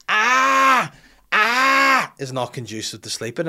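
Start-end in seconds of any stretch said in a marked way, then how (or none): 0.1-2.1 loud, high imitated baby shriek; sustained piercing mock baby yell; baby's shout. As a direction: blare it out high like a yelling baby. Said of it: "'cause of this noise".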